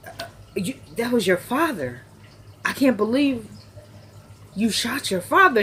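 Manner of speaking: mildly sad, halting retold shock; sad disbelief. Mother learning her son shot his father, mildly sad. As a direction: imitate a stunned mother, with pauses. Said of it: "sad and all that".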